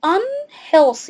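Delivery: "unhealthy" drawn out with the prefix un clear; slow model word. Shown as a demonstration. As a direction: stretch out "unhealthy" for the class. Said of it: "يعني un".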